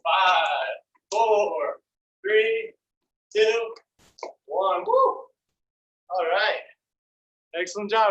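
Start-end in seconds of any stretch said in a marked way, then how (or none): 0.0-4.8 loud, punchy count to one; shouted countdown. Real, not acted. Instructor shouting final countdown. 4.9-4.9 whooping cheer. Real, not acted. Cheer after exercise finishes.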